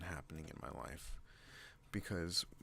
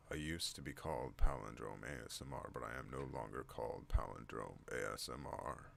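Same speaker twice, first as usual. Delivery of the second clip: lowered, deliberate announcer delivery; deep voice; 5 semitones lower than usual. Shown as a demonstration. Vlogger explaining the old channel name, low put-on voice. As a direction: drop the pitch and enunciate each word.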